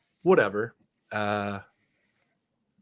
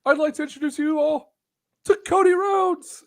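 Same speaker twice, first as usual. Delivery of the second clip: very high mock host introduction; silly high-pitched voice, stretching the big name; Tony Khan. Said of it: "And he's like".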